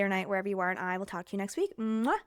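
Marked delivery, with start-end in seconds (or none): 1.8-2.2 blown kiss. Real, not acted. Blowing a kiss to listeners.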